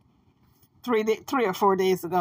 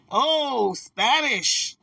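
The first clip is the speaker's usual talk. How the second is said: mock Spanish objectors; much slower than usual. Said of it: "here comes the Spanish folks after me now".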